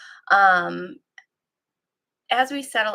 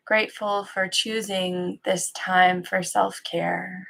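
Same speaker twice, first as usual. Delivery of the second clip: calm, even guiding voice with long pauses; guided meditation. Acted. Teacher settling listeners into practice, calm guided-meditation delivery. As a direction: ease listeners into stillness, leaving long silences between phrases.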